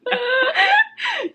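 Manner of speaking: high breathy giggle around a quick no; can't keep a straight face; giggling no. Real, not acted. Answering the co-host's teasing question, giggling. Last name question sets off laughter.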